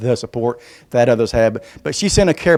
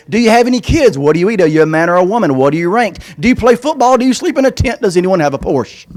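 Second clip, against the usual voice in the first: put-on higher, eager little-kid voice reading questions; kid's voice; 5 semitones higher than usual. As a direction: rattle off questions like an excited child.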